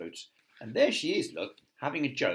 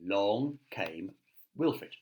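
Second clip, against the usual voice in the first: ordinary delivery, nothing marked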